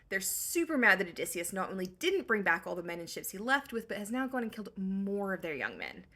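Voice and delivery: ordinary delivery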